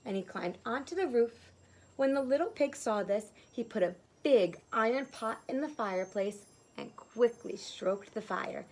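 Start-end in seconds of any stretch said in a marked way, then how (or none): none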